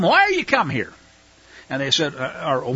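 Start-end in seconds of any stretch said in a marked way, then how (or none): 0.0-0.9 high-pitched, rough challenge in Joseph's role; acted-out rough demand, pitched up; rough questioning. Joseph questions his unrecognizing brothers, acted rough. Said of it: "He deliberately spoke roughly to them".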